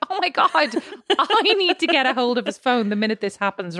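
high, laughing disbelief; exasperated laugh. Retold or imitated. Wife laughing at husband's phone habits, exasperated. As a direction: squeal it out, laughing at the mess. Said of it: "I'm like".

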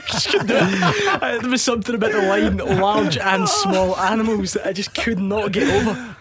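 real laughter in the voice; laughing. Real, not acted. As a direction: still cracking up. Laughing about failed read.

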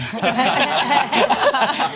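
high burst of laughter. Real, not acted. Group laughing at own joke.

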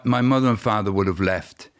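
ordinary delivery

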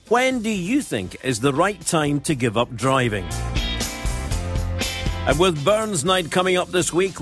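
projected, emphatic presenter headlines; scripted teaser with key words punched; radio menu read. Acted. Host telling listeners what is on the programme, radio menu read.

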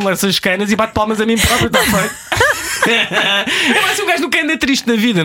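fast, high, laughing excitement; buzzing like too much caffeine; hyper. Real, not acted. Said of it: "Tô, tô a mil".

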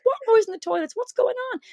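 high, innocent questioning; feigned innocence. Retold or imitated. Producer pretending not to know about the noise, high innocent tone. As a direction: play dumb with wide eyes. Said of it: "I said".

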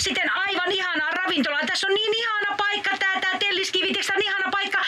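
high, bubbly wife chattering from Tallinn; a giddy, high-pitched holiday wife; Joulumuori voice. Acted. Wife calling Pukki from Tallinn, high and cheery.

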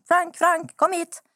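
high re-enacted call to a dog. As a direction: call your runaway dog back, pitched well up. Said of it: "då ropar jag efter honom".

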